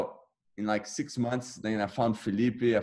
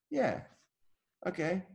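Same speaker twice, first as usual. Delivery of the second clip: startled agreement; 10 semitones higher than usual. Retold or imitated. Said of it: "And I go".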